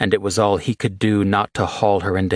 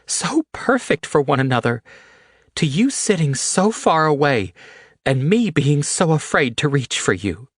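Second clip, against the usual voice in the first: much higher, aching plea; Haley, pleading; 11 semitones higher than usual. A narrator's reading. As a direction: beg him to meet her halfway.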